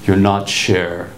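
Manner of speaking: low, slow, gentle warning; lightly voiced protective teacher. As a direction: drop down and lay it on slowly, kindly. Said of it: "He comes up to me and says".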